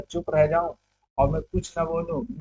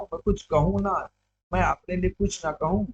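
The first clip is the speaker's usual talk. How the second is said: ordinary delivery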